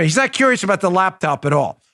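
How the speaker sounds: ordinary delivery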